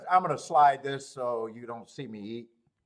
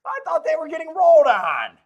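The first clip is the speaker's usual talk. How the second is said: higher and louder, exclaiming; excited outburst; 12 semitones higher, 12 dB louder than usual. Real, not acted. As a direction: blurt it out, worked up. Repeats the phrase louder.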